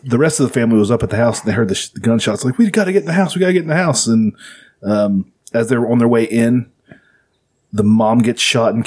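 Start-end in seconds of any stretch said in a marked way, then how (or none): 2.6-4.1 urgent. Retold or imitated. Family's panicked quote in retold shootout.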